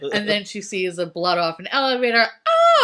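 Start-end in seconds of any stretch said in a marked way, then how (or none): 2.5-2.8 high, loud horror scream; mock scream. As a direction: scream like the frightened wife. Wendy screaming at blood.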